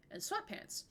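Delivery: normal talking voice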